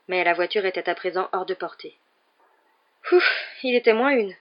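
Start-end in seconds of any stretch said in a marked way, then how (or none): 3.1-4.3 breathless, raised voice of relief; panting. A narrator's reading. Ron escaping the attacking tree, panting. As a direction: puff it out with relief. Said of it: "dit Ron d'un ton haletant".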